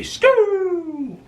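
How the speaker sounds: high siren wail; siren imitation. Imitating the police siren as cops pull up.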